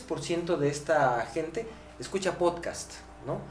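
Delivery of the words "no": usual speaking voice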